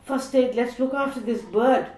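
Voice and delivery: put-on urgent child calling for help; mock yell. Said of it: "started yelling".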